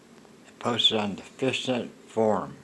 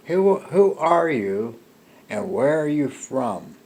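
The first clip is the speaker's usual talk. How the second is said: higher, louder, slowed retelling of a pointed question; bold demanding question; 5 semitones higher, 6 dB louder than usual. Retold or imitated. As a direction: press him for the truth.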